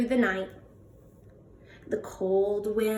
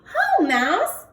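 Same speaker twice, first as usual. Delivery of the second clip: hare greeting; 8 semitones higher, 4 dB louder than usual. Acted. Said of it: "says Hare".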